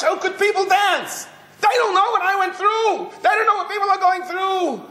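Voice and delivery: high, pained cry of hurt; anguished outburst. Retold or imitated. Grieving mother sees people dancing, high anguished voice. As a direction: ache at everyone else's joy.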